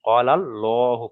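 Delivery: "কলাল্লাহু" slowly, with a long heavy "lo"; thick Allah. Shown as a demonstration. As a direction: recite "Qaalal-lohu" drawn out and rounded. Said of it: "পুর করে পড়তে হবে". ("কলাল্লাহু" পুর করে, টেনে "কলাল্লোহু")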